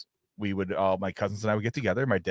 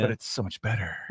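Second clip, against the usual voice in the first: whispering; 6 dB quieter than usual. Real, not acted. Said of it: "It's my D J whisper voice".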